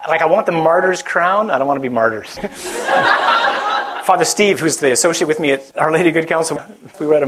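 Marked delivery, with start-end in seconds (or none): 2.4-4.0 high cackling laugh; high burst of laughter. Real, not acted. Laughing at his own martyr joke, high laugh. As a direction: let out a big high laugh.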